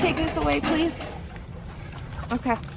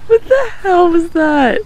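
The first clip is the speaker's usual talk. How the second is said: amused disbelief; 7 semitones higher, 6 dB louder than usual. Real, not acted. Reacting to odd climb.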